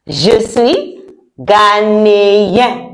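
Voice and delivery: very slow, syllable-by-syllable demo; slow model pronunciation. Shown as a demonstration. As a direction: stretch "ghanéen" out for learners to copy. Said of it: "yeah, like that".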